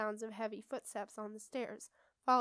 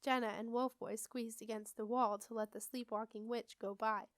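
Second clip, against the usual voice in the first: plain reading voice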